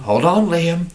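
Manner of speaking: urgent warning. Pretend. Crew warns before fling.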